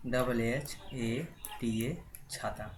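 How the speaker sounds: letters spelled slowly one by one; spelled out for students; slow spelling out. Shown as a demonstration. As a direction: dictate each letter slowly to the class. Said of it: "कैसे लिखेंगे".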